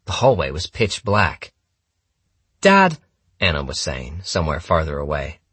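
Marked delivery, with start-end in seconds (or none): 2.6-3.0 high, loud call to Dad; daughter calling out. A narrator's reading. Anna to her father down the dark hallway, calling out.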